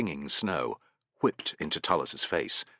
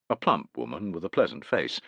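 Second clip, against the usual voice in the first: ordinary delivery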